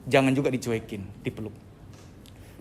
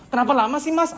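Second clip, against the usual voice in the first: high, irritated gripe at a waiter; impatient complaint; 9 semitones higher than usual. Acted. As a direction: snap impatiently in a raised pitch. Acted impatient customer scolding waiter. (keluhan kesal tak sabar)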